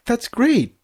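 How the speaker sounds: thrilled, smiling news reaction; delighted Flash. Acted. Flash hears he'll be a father, overjoyed and high. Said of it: "Flash smiled".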